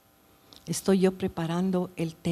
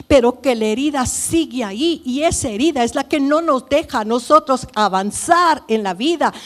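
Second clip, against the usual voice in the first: preaching at full force; 3 semitones higher, 4 dB louder than usual. Acted. Preaching to congregation.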